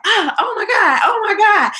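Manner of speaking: high-pitched, hammed-up squeal of alarm; mock excited scream. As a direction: reenact over-the-top screaming without full volume. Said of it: "I'm screaming 'cause I'm extra".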